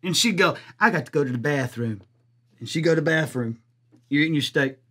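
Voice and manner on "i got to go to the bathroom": much lower and softer, sullen mumble; a pouting date storming off quietly; sulky girlfriend. Retold or imitated. Mimics the sulking girlfriend.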